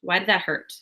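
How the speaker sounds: a quiet steady inner voice; calm self-talk. Pretend. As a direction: breathe out and ask yourself gently. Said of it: "take a deep breath and be like".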